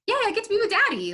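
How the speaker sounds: put-on high, quick toddler cheer; excited toddler. Mother voicing her toddler happy to be with daddy, excited. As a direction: imitate a delighted little kid, pitched high. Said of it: "And she's like".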